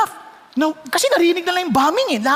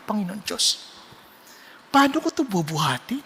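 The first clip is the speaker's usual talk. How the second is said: softer, lower whispered-off prayer; quiet prayer; 6 semitones lower, 6 dB quieter than usual. Retold or imitated. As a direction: pray it under your breath. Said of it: "tahimik lang ako".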